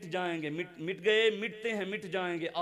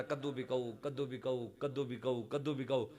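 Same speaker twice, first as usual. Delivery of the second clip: hushed vendor's call, softer; soft street cry; 5 semitones lower, 6 dB quieter than usual. Retold or imitated. Zahir hawking pumpkins, soft and quick. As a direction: call it out softly. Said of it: "حضرت ظاہر نے کہا".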